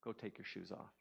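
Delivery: low, very soft instruction; calm inner voice. Acted. Inner voice instructing the speaker to go barefoot, calm. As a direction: speak quietly, like a calm voice within. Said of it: "in the calmness of all of it".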